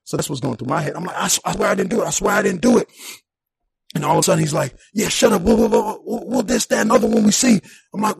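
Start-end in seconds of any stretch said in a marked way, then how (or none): 1.2-2.9 loud pleading, I swear; frantic pleading. Retold or imitated. Man pleading with cops he didn't do it, loud plea. 4.9-7.6 loud rough shut up; retold cop barking. Cop telling the handcuffed man to shut up, loud bark.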